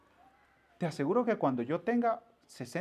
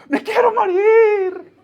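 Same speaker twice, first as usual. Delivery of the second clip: high wailing scream, slow and sobbing; a child howling through tears; crying tantrum shout; 13 dB louder, much slower than usual. Retold or imitated. The boy throwing a crying tantrum at his mother, screaming. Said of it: "empezó a llorar y a gritar".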